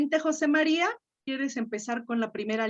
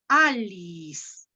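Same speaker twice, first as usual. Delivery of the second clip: Alice read slowly as A-li-is, stressed on A; 4 semitones lower than usual. Shown as a demonstration. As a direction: hit the first A of Alice, then let it fall. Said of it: "se va hasta la A". (Alice con el acento en la A, despacio)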